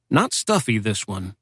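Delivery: plain reading voice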